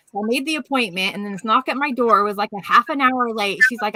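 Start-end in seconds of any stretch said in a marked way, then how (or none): none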